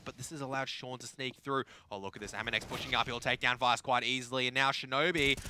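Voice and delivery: ordinary talk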